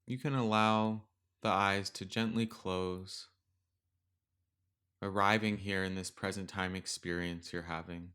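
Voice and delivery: gentle metta meditation read; guided meditation. Acted. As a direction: ease the listener into stillness with long pauses.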